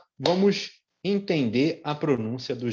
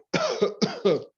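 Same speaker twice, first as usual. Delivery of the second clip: demo cough. Shown as a demonstration. Demonstrates a cough.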